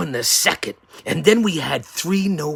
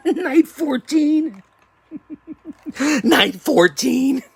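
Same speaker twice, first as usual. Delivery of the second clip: high, slow words through laughter; can't keep a straight face; announcing while still giggling; laughing; 11 semitones higher, slower than usual. Real, not acted.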